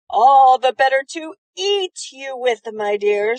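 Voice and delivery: loud, menacing snarl; snarling wolf. A narrator's reading. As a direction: snarl it out with bared teeth. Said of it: "snarled the wolf".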